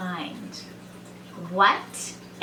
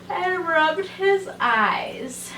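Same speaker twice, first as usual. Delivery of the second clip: slow, higher-pitched drawn-out narration; sleepy slowness; 7 semitones higher, much slower than usual. A narrator's reading. Narrator on the sleepy bear, slow and drawn out. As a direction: drag it out as if just waking.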